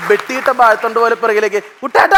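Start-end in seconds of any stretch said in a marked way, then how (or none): 1.8-2.2 high, loud cry while toppling; falling scream. Retold or imitated. Teacher crying out as he falls backwards, loud and high. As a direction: yell it as you fall backwards.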